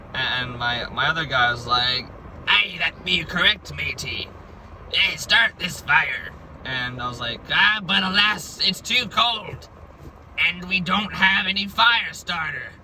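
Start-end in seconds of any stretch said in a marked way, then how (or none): 2.5-6.3 hammed-up stage pirate, slowing at the end; mock pirate talk. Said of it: "we spoke like pirates". 7.5-12.7 higher, hammed-up pirate voice; a stage pirate complaining theatrically; mock pirate lament. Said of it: "we spoke like pirates".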